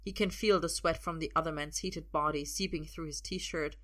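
ordinary delivery, nothing marked

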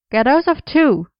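high, loud demand. A narrator's reading. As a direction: snap out the order. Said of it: "he demanded".

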